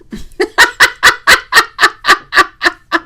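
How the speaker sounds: laugh. Real, not acted. Laughs at Juanson's joke.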